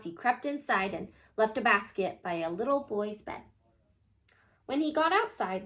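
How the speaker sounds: plain reading voice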